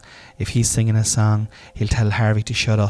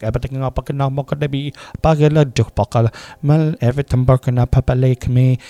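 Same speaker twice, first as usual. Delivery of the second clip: louder mock toddler garbling the affirmation; dad imitating his three-year-old's garbled affirmation; 3 semitones higher, 6 dB louder than usual. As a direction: mangle the words like a toddler with a speech impediment. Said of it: "That's the way it kinda comes out".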